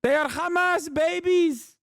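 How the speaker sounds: high-pitched, hammed-up Arabic-accented impression; mock Arabic accent. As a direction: parody the guest in a thick Arabic accent. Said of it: "He just sounds like that".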